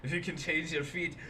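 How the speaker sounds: movie line in mock Scottish; Scottish accent. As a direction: quote it with an attempted Scots accent. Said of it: "it's that line from Brave".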